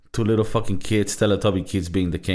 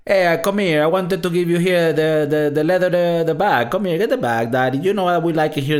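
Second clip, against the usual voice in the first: hammed-up Italian-American mobster accent, higher and louder; exaggerated Italian accent, pitched up and loud, quickening at the end; mock Italian accent; 8 semitones higher, 4 dB louder than usual. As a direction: play a cartoon Italian wiseguy offering the leather bag in an Italian accent. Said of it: "that's not how Italians speak".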